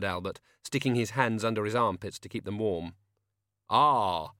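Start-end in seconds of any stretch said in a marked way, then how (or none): 3.7-4.3 very slow, booming, grave voice; Death's voice. Acted. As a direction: drag each word out like a tomb.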